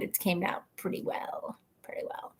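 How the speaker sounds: breathy whispered aside. Real, not acted. Whispering to viewers about her pizza. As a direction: let it drop to a whisper.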